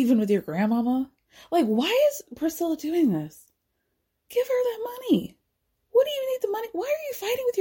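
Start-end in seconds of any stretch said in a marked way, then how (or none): 1.5-7.6 exasperated plea. Real, not acted. Pleading at Priscilla over money.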